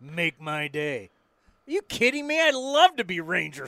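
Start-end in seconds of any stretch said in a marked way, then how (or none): 0.0-1.1 a hint of Dirty Harry, lower and slow; lightly voiced tough cop. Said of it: "Little Dirty Harry".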